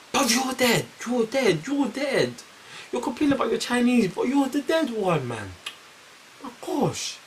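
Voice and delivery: high, incredulous mocking voice; mock exasperated roast. To the girl complaining about her Chinese, high mocking disbelief.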